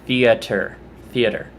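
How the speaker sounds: "theater" as "THEE-uh-ter", stress first; own pronunciation. Shown as a demonstration. Giving viewers her version, "theater" as "THEE-uh-ter". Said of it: "when I say theater".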